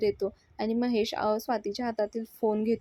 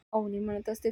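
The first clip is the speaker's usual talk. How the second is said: ordinary delivery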